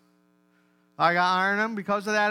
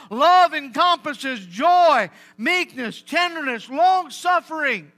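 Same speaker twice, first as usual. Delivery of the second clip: loud, fervent sermon delivery; louder, rising preacher's call; full-force preaching; 5 semitones higher, 7 dB louder than usual. Acted. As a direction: preach it out to the congregation. Preaching on love to husbands.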